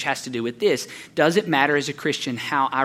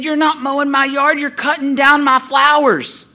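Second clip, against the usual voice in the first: high, loud scolding of a neighbor; indignant, raised-voice imitation; screaming old lady; 11 semitones higher, 6 dB louder, slower than usual. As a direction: play the outraged old woman. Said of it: "she starts screaming".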